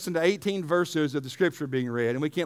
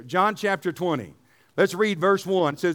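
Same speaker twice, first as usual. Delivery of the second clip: usual speaking voice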